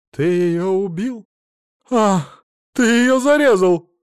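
high, slow, rising cry; horrified accusation. A narrator's reading. Mother-in-law asks if he killed her daughter, horrified cry.

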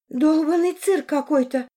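sighing with annoyance; exasperated sigh. A narrator's reading. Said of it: "вздохнула Арина".

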